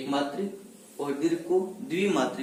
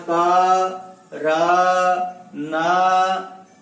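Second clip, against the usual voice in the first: का, रा, ना loud, each आ sustained; vowel stress; 2 semitones higher, 5 dB louder, much slower than usual. Shown as a demonstration. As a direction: hold and push the आ in का, रा, ना. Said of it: "आ स्वर पर बल अधिक पड़ने के कारण".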